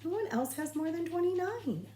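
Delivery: rising, high fretful count; a flustered teacher recounting her desks. Acted. Teacher fretting to herself about the crowded room, high and rising. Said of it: "kept mumbling over her double chin".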